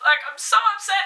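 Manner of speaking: high-pitched mock crying to dad; retold weepy phone whine; tearful wail. Crying on the phone to dad, tearful mock wail. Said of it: "I'm literally crying at this point".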